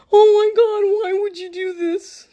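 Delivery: hammed-up despair; distraught family. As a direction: wail like it's a tragedy. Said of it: "family reacts again".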